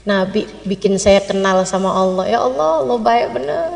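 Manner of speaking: soft thanks to God, fading away; hushed, heartfelt praise; grateful musing. Acted. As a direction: pray gratefully under your breath. Traveler thanks God to himself.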